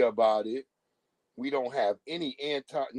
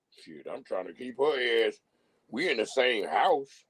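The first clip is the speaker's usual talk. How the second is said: a lightly lowered, put-on husband voice; low mock husband voice; 5 semitones lower than usual. Man admitting why he stays, lightly lowered mock voice. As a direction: drop the voice and play the guilty husband. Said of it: "Just say that".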